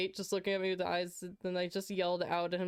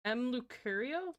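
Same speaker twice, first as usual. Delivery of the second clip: ordinary talk, nothing marked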